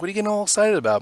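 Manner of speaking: mock incredulous. Teasing Josh about his excitement over Uber, high and incredulous. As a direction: rib a coworker with a high, incredulous question.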